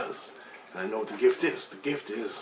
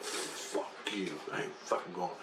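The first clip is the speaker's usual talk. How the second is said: 1 muttered refusal; 3 semitones lower, 11 dB quieter, slower than usual. Retold or imitated. Refusing the aliens' call.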